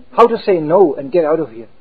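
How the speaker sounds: usual speaking voice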